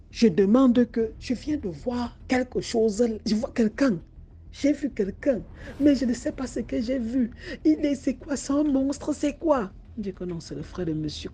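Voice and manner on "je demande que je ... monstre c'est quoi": frightened woman. Acted. Woman describes seeing a monster.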